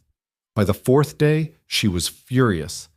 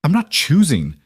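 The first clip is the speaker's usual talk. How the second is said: voice breaking; 7 semitones higher, 4 dB louder than usual. A narrator's reading. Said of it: "her voice breaking".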